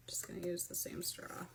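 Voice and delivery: hushed unvoiced whisper. Real, not acted. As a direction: whisper it as an aside. Quiet aside while opening drink.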